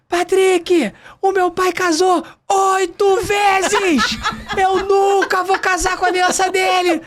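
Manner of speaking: high, slow laughing mock shout of refusal. Bride refusing father's ring to groom, high laughing shout. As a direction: bride yelling through laughter, high and drawn out.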